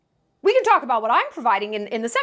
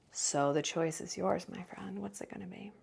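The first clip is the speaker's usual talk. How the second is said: a hushed dare that trails off into whispering; menacing whisper; 5 semitones lower, 13 dB quieter than usual. Real, not acted. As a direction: fade into a threatening whisper. Menacing closing challenge.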